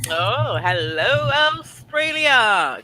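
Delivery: calling out. Pretend. Calling out to viewers.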